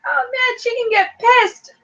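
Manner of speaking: high, drawn-out chatty voice; mock her British phrasing; imitating a British woman. Cast member telling Daniel about getting pissed, high drawn-out voice. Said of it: "she's like".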